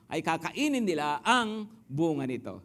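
ordinary talk, nothing marked

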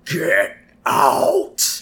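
demon-esque roar; monstrous, hammed-up mother's yell; Marge. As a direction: yell it like a demon, long and drawn out. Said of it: "Marge just yells this demon-esque".

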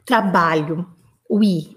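usual speaking voice

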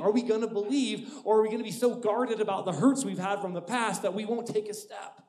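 loud, rising preacher's appeal; full-force preaching. Acted. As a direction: preach it out to the crowd.

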